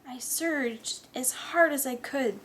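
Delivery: plain reading voice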